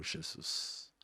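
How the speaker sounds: breathless whisper of the name; barely voiced, pleading; whispered prayer. Son alone at night whispering to Jesus, hushed.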